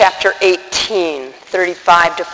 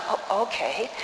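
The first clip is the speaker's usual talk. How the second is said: very soft, taken-aback mumble; stunned; 14 dB quieter than usual. Retold or imitated. Followers told to call the beggar, answering in a stunned hush. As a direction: agree quietly, as if stunned. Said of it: "they're probably stunned".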